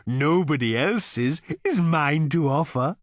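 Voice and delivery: high, slow, contented cow voice; a cow reasoning calmly; cow character. A narrator's reading. Cow reasoning with Arthur, in a high, slow contented voice.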